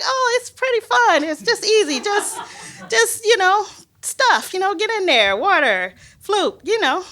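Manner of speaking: imitated high, airy colleagues; breezy reassurance. Colleagues reassuring a first-time snorkeler, breezy and high.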